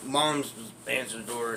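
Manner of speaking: ordinary talk, nothing marked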